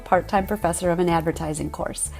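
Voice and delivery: bright announcer read over the theme music; podcast intro read. Acted. Host opening her podcast for listeners, in an announcer read. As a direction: welcome listeners like a radio host.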